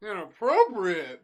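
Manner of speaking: mocking echo. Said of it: "echoes the boss's word".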